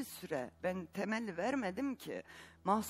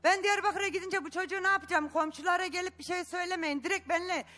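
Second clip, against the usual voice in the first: shouting her words back; 8 semitones higher than usual. Acted. Daughter-in-law shouting at the grandmother on TV.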